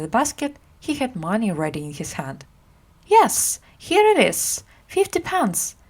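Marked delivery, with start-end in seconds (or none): 3.1-5.8 much higher mock discovery of the coin; feigned surprise. A narrator's reading. Miles tricks the woman over the basket, mock surprise. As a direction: pretend to find the money. Miles fakes finding the money.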